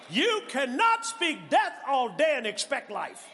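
high, loud, shouted preaching; preaching at full force. Real, not acted.

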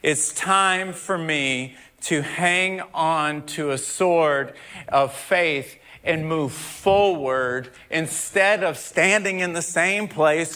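ordinary delivery

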